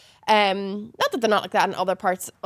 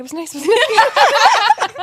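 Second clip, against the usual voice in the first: laughing; 7 dB louder, much slower than usual. Retold or imitated. Retold own thought after moving.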